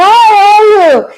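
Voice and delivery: high, loud bright hello; excited high-pitched hi to friends from church; cheery greeting. Retold or imitated. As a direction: sing out a happy hi to friends. Greeting friends after a jog.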